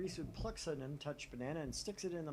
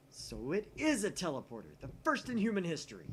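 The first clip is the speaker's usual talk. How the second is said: rising higher and louder in triumph; triumphant; 7 semitones higher, 9 dB louder than usual. Acted. Announcing the first teleporter in history, loud and triumphant. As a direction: declare victory, voice climbing.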